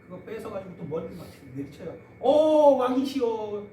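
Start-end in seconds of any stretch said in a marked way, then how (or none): 2.2-3.7 loud, higher mock cry to a king. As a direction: shout it out in mock worship. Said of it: "막 절규해요".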